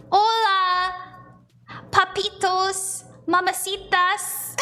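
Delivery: put-on slow, playful Spanish accent; teasing Spanish-accented hello; Spanish greeting. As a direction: greet them in drawn-out Spanish. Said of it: "my Spanish friends".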